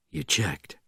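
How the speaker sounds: low, soft, unruffled; quiet question. A narrator's reading. Bourne questioning the panicking sergeant, low quiet voice. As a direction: ask coolly under the screaming.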